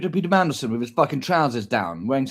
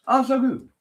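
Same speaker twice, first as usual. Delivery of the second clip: mock delight; 10 semitones higher than usual. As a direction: gush like it's the best day ever. Hammed-up joy over yogurt.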